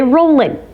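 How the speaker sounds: "rollin'" said with a short final n and no g; "rollin'" the way a typical Kentuckian drops the g; Kentucky rollin'. Shown as a demonstration. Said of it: "He'll say".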